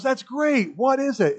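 high pitched happy father voice; old dad. Retold or imitated. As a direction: lift it up like the old man on the phone. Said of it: "he goes".

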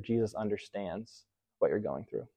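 tender and soft. Real, not acted. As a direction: lay "Jesus understands" down slowly and gently, almost hushed. Repeated line, said softly and slowly.

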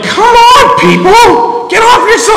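high, loud mock yell at drivers; hammed-up honking frustration; mock road rage. Pastor mimicking himself yelling at distracted drivers, loud and high. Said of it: "I'm like".

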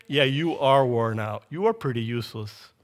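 mock dismissive agreement. Voicing what God doesn't say to Sarah, mock dismissiveness. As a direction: agree offhandedly, pitched low, as an imagined put-down. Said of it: "what else he doesn't say".

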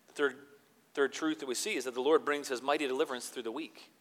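normal talking voice

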